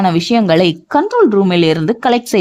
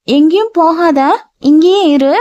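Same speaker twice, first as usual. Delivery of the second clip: put-on childlike voice; 8 semitones higher than usual. A narrator's reading.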